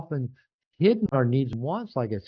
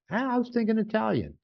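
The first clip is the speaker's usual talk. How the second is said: partner's suggestion; 9 semitones higher than usual. Retold or imitated. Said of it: "Or they'll go".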